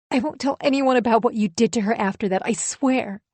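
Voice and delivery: high, urgent begging; desperate pleading. Acted. Wife begs her husband to confess, high desperate pleading. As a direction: beg him, voice pushed up.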